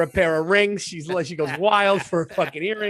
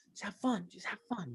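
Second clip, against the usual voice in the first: much softer, hushed encouragement; quiet reassurance; 5 semitones higher, 13 dB quieter than usual. Retold or imitated. Dad quietly reassuring daughter at the drill, hushed. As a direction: murmur it so only she hears.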